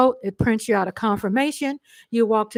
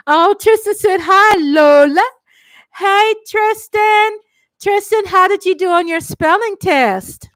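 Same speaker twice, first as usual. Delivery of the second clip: higher, louder, sing-song questions; excited grandma; 7 semitones higher, 7 dB louder than usual. Real, not acted. Grandma asking grandson about his spelling test, excited. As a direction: cheer him on.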